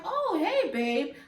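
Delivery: feigned nonchalance. Retold or imitated. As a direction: play innocent, sing it out high and loud. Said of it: "pretending like he doesn't know".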